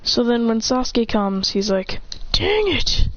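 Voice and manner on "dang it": high, strained exclamation; mock anime exasperation; acted frustration. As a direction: curse it high-pitched. Character frustrated in fight.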